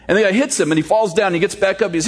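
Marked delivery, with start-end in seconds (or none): none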